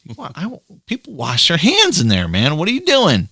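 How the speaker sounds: mock shocked outburst climbing very high; a kid appalled at the sink, voice jumping up high; high-pitched retold disbelief. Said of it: "I was like".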